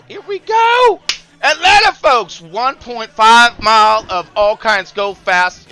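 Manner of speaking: high, loud, slow hype; hype announcer. Acted. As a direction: sell the track big. Hyped track announcement.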